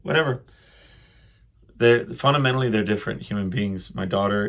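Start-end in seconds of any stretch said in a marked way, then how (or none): none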